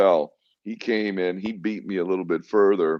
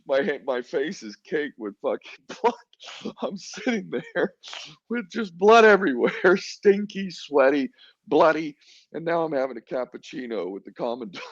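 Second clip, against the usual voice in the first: much higher, laughing through the words; laughing while telling; 9 semitones higher than usual. Real, not acted. Recalling coffee with the commandant while covered in blood, laughing. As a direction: let real laughter break into the story.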